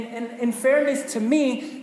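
normal talking voice

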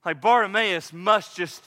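ordinary delivery

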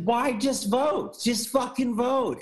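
pitched high, slow exasperation; slow, high, fed-up plea to vote; exasperated plea. Real, not acted. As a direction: beg them to just vote.

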